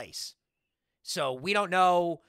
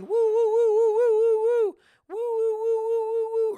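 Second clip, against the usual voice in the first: very high, loud sung woo, woo, woo; Charlotte Flair woo; 7 dB louder than usual. Retold or imitated. The host imitates a wrestler coming out chanting, high sung woos. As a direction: belt out the wrestler's trademark woo like a crowd call. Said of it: "she just starts going".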